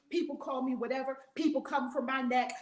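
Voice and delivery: ordinary talk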